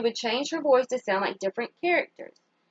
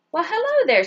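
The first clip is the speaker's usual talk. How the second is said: higher, louder grandma greeting; delighted grandma; 7 semitones higher, 6 dB louder than usual. Acted. Grandmother answering Kenya's call, delighted.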